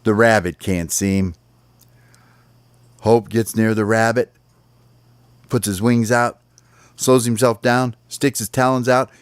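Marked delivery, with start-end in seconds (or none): none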